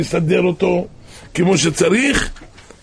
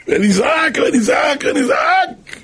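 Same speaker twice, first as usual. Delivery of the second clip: high, loud, drawn-out wail; acted crying out; 12 semitones higher, 5 dB louder, slower than usual. Husband shows his wife how to cry to God, wailing shout. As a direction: shout it repeatedly, climbing in pitch. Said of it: "אמר לי לצעוק ולצעוק".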